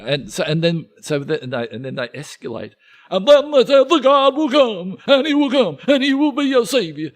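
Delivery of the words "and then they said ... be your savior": escalating preacher. Retold or imitated. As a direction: build it up like a revival preacher. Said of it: "it's a bit like an auctioneer".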